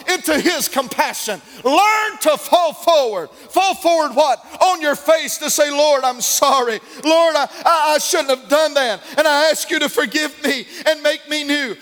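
loud, high preaching climax; rising preacher's fire; preaching at full force. Acted. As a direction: lift the church with it, shouting.

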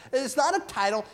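usual speaking voice

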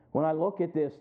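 normal talking voice

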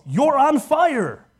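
loud, high, drawn-out yell; alarmed yell. Acted. Someone yells to a person on fire, alarmed yell. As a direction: yell it out as an urgent warning. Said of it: "when someone yells".

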